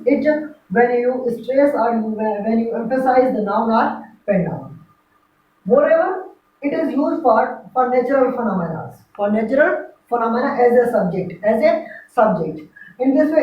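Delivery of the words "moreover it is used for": ordinary delivery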